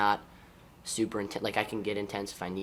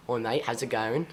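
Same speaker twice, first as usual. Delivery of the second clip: put-on amateur British accent that slides toward Australian; a British accent attempt that comes out more Australian; 3 semitones higher, 4 dB louder than usual. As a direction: greet your teammates in a put-on British accent, quick and a bit louder. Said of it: "Can you throw in a Brit accent".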